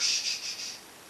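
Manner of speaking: whispered shh to keep the house asleep; shushing. Retold or imitated. Brother-in-law woken at night shushing him in a whisper. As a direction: hush him without a voice.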